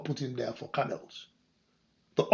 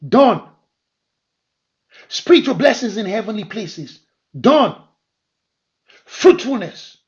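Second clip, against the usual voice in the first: rising, louder preacher's cadence with each "done"; preaching; 6 semitones higher, 9 dB louder than usual. Acted. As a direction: build it up, hammering every "done". Preacher building to a climax.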